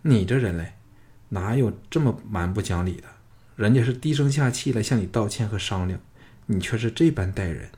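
ordinary delivery, nothing marked

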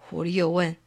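ordinary delivery, nothing marked

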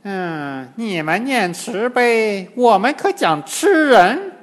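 scornful hum, then high, loud demon voice; snort, then gloat as an old demon. A narrator's reading. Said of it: "金蝉老妖哼了一声说". (老妖哼了一声，高声讥讽)

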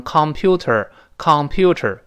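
"computer" with a full, strong "con" first syllable; common learner mispronunciation. Shown as a demonstration. Teacher imitating a common mistake, over-full first syllable. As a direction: say "computer" as COM-puter, first vowel unreduced. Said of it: "好多人容易把它读成".

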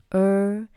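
'儿' as a drawn-out American 'er', tongue curled; retroflex R sound. Shown as a demonstration. Teacher showing American pronunciation, curled-tongue 'er'. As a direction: hold '儿' as an r-coloured vowel. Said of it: "卷舌".

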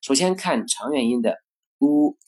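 ordinary talk

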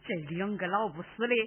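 soft, low laughing sneer; gloating laugh. Acted. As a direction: laugh quietly in secret triumph. Villainess gloats over deaths. (暗自得意地笑)

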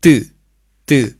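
的 pushed out as a tense, heavy t; heavy reading. Shown as a demonstration. Teacher repeating for learners, 的 read heavily. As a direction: say 的 with force and a sharp fall. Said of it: "重读的".

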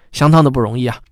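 ordinary delivery

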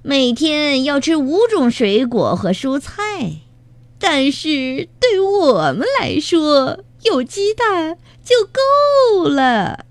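high, drawling villain; jeering bandit chief, rising high and slow; 强盗巴巴, mocking. A narrator's reading. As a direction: recite mockingly, then stretch out the punchline. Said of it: "强盗巴巴笑道".

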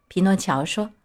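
usual speaking voice

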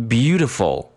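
beautiful with BEAU held long, the rest short; long stressed syllable. Shown as a demonstration. Teacher corrects the rhythm for students, long stressed syllable. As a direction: hold the first syllable of beautiful. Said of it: "重音音阶分配的时间一定要更长".

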